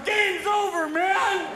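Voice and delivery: high, loud mock panic; panicked agent. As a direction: shout it like an agent losing it. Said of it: "Secret Service are going".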